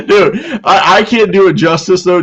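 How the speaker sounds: high, loud laughing words; real laughter. Real, not acted. Host laughs to co-host after the story. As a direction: laugh through the line.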